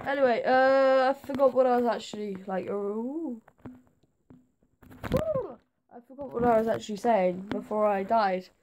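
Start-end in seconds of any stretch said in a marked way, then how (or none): 5.1-5.6 high ooh. Real, not acted. Silly noise while forgetting.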